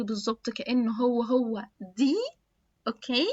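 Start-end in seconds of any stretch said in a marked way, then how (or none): none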